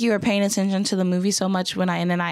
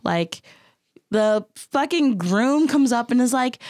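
ordinary delivery

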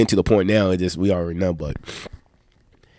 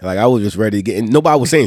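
ordinary talk

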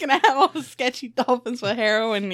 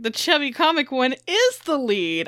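rising giddy hope, slowing down; excited inner voice; 7 semitones higher, 5 dB louder than usual. Retold or imitated. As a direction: gasp of sudden hope. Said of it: "I'm like".

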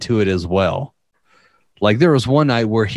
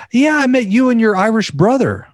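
high-pitched mimicked woman; imitated girl; 12 semitones higher than usual. Applicant reminds the fake Irishman they met, high imitated girl voice. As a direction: mimic her recognition in a raised, high voice. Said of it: "She was like".

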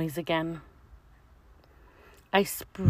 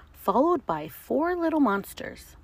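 ordinary delivery, nothing marked

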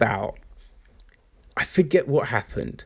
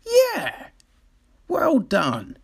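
high, bright-faked well done; mock praise; 14 semitones higher, much slower than usual. Praising the church's good deeds in a high mock cheer. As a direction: cheer them on, overdone.

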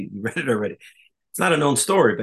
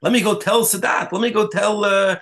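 mock Kissinger; 7 semitones higher than usual. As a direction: ham up a diplomat rushing to tell everyone. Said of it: "Henry Kissinger says".

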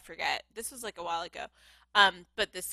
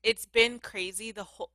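usual speaking voice

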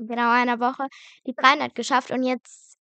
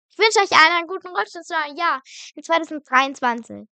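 excited; 5 semitones higher, 6 dB louder than usual. Real, not acted. Cheerful New Year wish.

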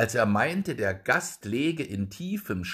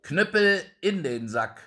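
lauter, höher gerufen; gerufener Befehl; 7 semitones higher, 4 dB louder than usual. Acted. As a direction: ruf den Knüppel streng zurück. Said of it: "Dann rief er". (der Geselle ruft den Knüppel zurück, laut befehlend)

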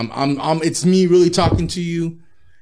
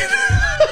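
high shouting laugh; real laugh erupting; high loud laugh; 3 dB louder than usual. Real, not acted. Laughing at own story.

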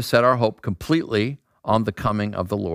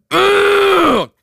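long, loud, high straining groan; exaggerated roar of effort; weightlifter's grunt; 9 dB louder than usual. Imitating big guys throwing weights down in the gym, straining grunt.

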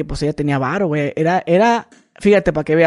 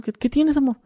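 put-on higher, sweet coaxing girlfriend; 8 semitones higher, 3 dB quieter than usual. Girlfriend asking her boyfriend for a kiss, higher and sweet. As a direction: coax him like a clingy girlfriend.

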